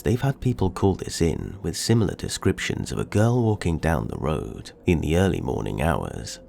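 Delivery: plain reading voice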